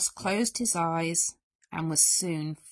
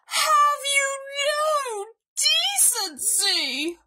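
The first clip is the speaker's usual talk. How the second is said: high, loud outraged bellow, drawn out at the end; bellowing bear; 4 dB louder, slower than usual. A narrator's reading. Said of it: "bellowed Bear".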